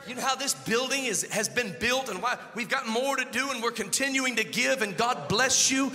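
loud, half-sung preaching; fervent sermon build over music; preaching at full force. Acted. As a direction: drive it home to the congregation. Preaching about giving.

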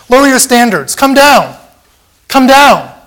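high, loud jeering cry; taunting crowd yell; mocking shout. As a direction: jeer at him like the mob at the cross. Voicing the mocking world.

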